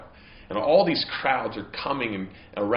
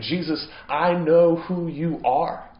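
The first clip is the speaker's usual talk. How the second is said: higher, louder, emphatic confession of faith; fervent plea; 7 semitones higher, 7 dB louder than usual. Retold or imitated. Blind man declaring to Jesus who he is, fervent and loud. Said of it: "So he's really saying".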